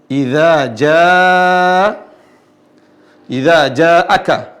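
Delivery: JAA drawn out as a clear open "a"; thin long alif. Shown as a demonstration. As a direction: recite idza jaa slowly without rounding to "o". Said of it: "Ja tidak dibilang jo". (jaa panjang, bunyi a tipis)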